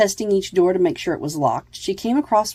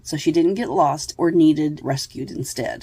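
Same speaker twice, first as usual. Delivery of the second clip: usual speaking voice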